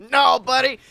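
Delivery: ordinary talk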